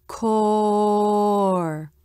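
"core" drawn out long, vowel rounded into the R; AH before R. Shown as a demonstration. Teacher showing how R changes the ah, "core" held long with rounded vowel. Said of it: "the sound is".

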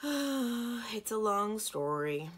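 long sigh, then slow, weary words. A narrator's reading. Prince reluctant to explain his folly, sighing heavily. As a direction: heave a big sigh and stretch the words out. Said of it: "The prince sighed".

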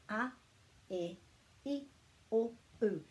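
short, clipped-off pure vowels a, e, i, o, u. Shown as a demonstration. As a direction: say a, e, i, o, u briefly, one at a time. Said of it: "Those sounds are quite short".

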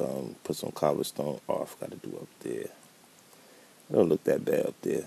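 ordinary talk, nothing marked

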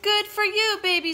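put-on high, loud child's congratulations; excited praise. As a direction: cheer the baby on. Girl praises baby's find.

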